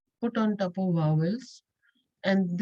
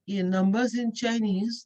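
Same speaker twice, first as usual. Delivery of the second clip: ordinary delivery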